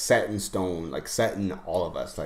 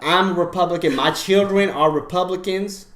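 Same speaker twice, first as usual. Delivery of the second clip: loud, high-pitched Southern Republican; Southern accent; 8 semitones higher, 8 dB louder than usual. Acted. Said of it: "I automatically go to a, like, Southern accent".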